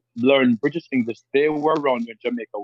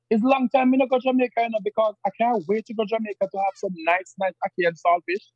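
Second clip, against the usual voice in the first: Jamaican patois with a British lilt, pitched much higher; British-tinged patois; 8 semitones higher than usual. Retold or imitated. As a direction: mimic an older Jamaican longing for home. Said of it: "a little British thing to it".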